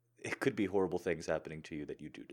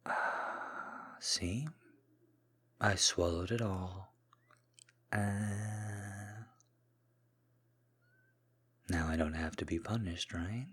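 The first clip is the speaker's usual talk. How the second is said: submissive voice; slower than usual. Acted. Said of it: "the more submissive side of me".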